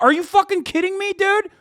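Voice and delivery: high, loud, stunned disbelief; incredulous rant. Retold or imitated. Ranting at the newspaper for its interview, high loud disbelief. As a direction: fire it off as if you can't believe it. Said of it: "It's like".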